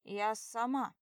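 loud stubborn insistence; insisting. Acted. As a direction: insist loudly. Drunk insisting.

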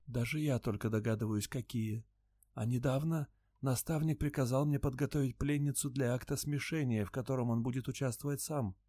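a timid creature explaining quietly; soft alien voice. A narrator's reading. Luun's held voice.

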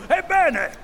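loud, high outburst slowing down; menacing resolve. A narrator's reading. Captain will face the bogey himself, menacing declaration. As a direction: burst out high and loud, then drag the words menacingly. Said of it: "alzò minaccioso il moncherino".

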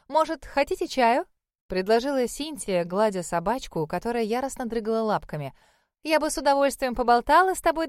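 high, loud gushing hostess; chatty older lady. Acted. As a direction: gush warmly at the guest.

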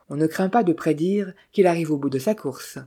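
ordinary delivery

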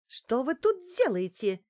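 half-screamed question, pitch jumping up; hysterical yell. A narrator's reading. Client finding a fight in the corridor, hysterical yell. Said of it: "истерично завопила Неделькина".

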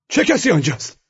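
high, loud harsh officer's demand. A narrator's reading. SS officer demanding who is inside the compartment. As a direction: bark the question into the compartment.

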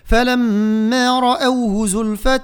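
slow melodic tajweed chant; Quran recitation. Shown as a demonstration. Teacher chanting the verse for students, slow and melodic.

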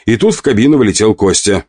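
usual speaking voice

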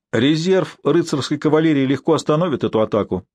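ordinary delivery

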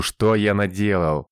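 plain reading voice

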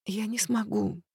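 soft muffled whisper, face in chest; whispering into his chest. Acted. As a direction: breathe it into his shirt. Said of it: "прошептала ему в грудь".

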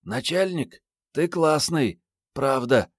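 put-on high girl's voice, admiring; young woman. A narrator's reading. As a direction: praise him in a high female voice. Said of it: "девушка сказала". (высокий девичий голос)

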